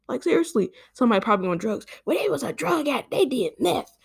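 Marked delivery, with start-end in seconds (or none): 2.0-4.0 high, snooty mock voice; mocking commenter. Parodying critics of shooting victims, snooty voice. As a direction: ham up a smug critic.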